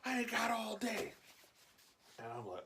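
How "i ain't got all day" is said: high-pitched, drawn-out yell of an imitated old man; impatient yelling. Old veteran yells at slow shoppers, mock impatient yelling. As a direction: holler it high like a fed-up old customer. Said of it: "I hear him start yelling".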